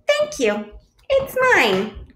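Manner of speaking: high, proud quick claim; quacking Duck. A narrator's reading. Duck answers the admiring bluebird, high and proud. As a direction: pipe it up high. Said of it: "quacked Duck".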